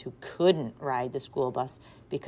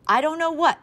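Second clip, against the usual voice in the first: harried; 13 semitones higher, 8 dB louder than usual. A narrator's reading. Harried mother.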